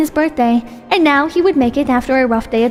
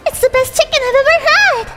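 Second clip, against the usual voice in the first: put-on high sweet girlish voice; bright, breathy young girl; high cute anime girl; 11 semitones higher than usual. Character thrilled by food.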